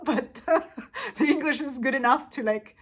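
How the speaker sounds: talking through a real laugh; giggling while explaining. Real, not acted. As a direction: laugh your way through the words, amused at yourself. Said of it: "So it's very funny".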